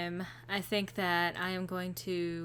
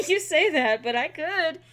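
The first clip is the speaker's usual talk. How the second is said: laughing protest; 7 semitones higher, 6 dB louder than usual. Real, not acted. Playful banter with Griffin.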